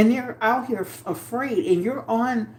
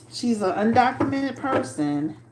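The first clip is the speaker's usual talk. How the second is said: ordinary delivery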